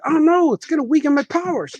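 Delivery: a comic hero fearing for his powers, played light; mock cartoon panic. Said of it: "he starts reacting to it".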